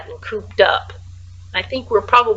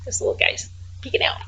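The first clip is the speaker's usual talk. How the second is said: soft whispered aside; 12 semitones lower, 8 dB quieter, much faster than usual. Real, not acted. Pointing out a peeking mouse, whispered. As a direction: hush it like sharing a secret. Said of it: "whispered aside to viewers".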